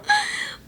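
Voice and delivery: a bright giggle up high; delighted high laugh. Acted. Wife giggling at husband's flowers, delighted.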